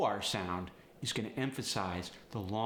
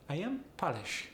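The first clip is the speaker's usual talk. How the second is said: higher-pitched Polish accent demo; 6 semitones higher than usual. Shown as a demonstration. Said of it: "Switch it to an A".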